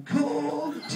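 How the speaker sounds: high mock yell of the punchline; retold shout.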